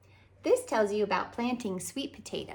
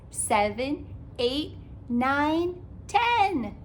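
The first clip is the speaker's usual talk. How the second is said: building up higher and louder to the last number; excited counting; 6 semitones higher, 5 dB louder, much slower than usual. Acted. Counting sweet potatoes with the kids, building excitement. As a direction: count up to a happy climax.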